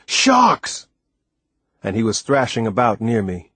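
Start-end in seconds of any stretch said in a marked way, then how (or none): 0.0-0.9 high, alarmed shout of warning; frightened roar. Acted. Timothy warns the boy in the water, frightened roar. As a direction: cry out in sudden fear. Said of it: "Timothy's frightened roar".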